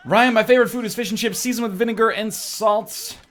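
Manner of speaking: high-pitched mock chat-message voice; hammed-up high recital of a fan's message; put-on reader voice.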